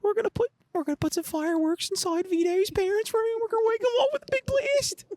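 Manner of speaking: very high-pitched, strained reality-show prankster voice; Bam Margera. Acted. Asked how Bam sounds, a high-pitched impression. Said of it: "what would Bam sound like".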